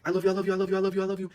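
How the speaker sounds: high, loud ecstatic scream; screaming back. Retold or imitated. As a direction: shout your love back at full voice. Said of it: "So I started screaming back".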